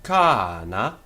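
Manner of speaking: "cana" with a long first a that rises and falls; circumflex accent. Shown as a demonstration. Teacher showing the accent, "cana" with circumflex first syllable. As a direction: draw out the first vowel of "cana" in a swell. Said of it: "has got the circumflex accent".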